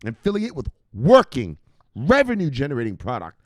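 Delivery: loud, punched-out sales rant; forceful hype. Real, not acted. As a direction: hammer each selling point. Hype rant between shouted bangs.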